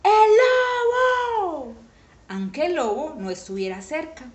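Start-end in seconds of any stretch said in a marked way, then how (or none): 0.0-1.7 high, loud, drawn-out cry of alarm; shout. A narrator's reading. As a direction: yell it out high, stretching the vowels.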